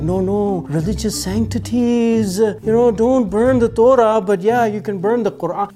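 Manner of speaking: mocking authorities. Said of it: "are like".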